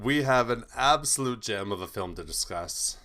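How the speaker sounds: usual speaking voice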